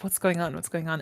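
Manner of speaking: ordinary delivery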